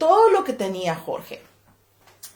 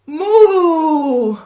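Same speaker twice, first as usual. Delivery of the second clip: higher, louder playful moo; playful cow moo; 5 semitones higher, 6 dB louder than usual. Acted. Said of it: "Jorge dijo".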